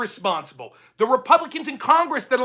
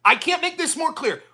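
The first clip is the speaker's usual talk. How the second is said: ordinary talk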